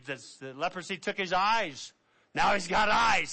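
ordinary delivery

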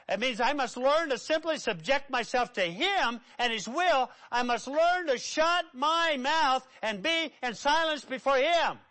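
high, loud pulpit emphasis; full-force preaching. Acted. Preacher urges submission to God's will, forceful preaching. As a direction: press each word home, pitch climbing.

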